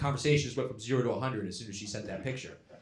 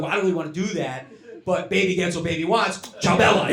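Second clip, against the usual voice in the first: fast, eager, giddy agreement; 5 semitones higher, 7 dB louder than usual. Pretend. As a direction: gush like a smitten fool. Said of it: "I'd be like".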